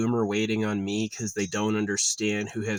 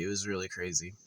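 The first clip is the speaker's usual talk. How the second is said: much softer repeat under the breath; quiet echo; 3 semitones lower, 8 dB quieter, faster than usual. Shown as a demonstration. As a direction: murmur it again to yourself.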